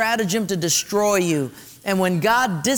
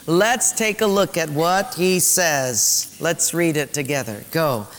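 usual speaking voice

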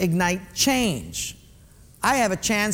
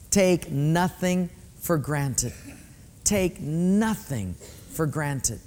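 slow emphasis; much slower than usual. Shown as a demonstration. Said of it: "repeated slowly for emphasis".

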